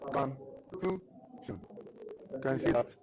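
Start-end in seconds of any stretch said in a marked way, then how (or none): 0.1-1.6 "Conclusion" in very slow separate syllables, softer, middle one higher; stressed middle syllable. Shown as a demonstration. Teacher repeating an example for the class, raised middle syllable in "Conclusion". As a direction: say "Conclusion" syllable by syllable, lifting the second. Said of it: "higher than the other part".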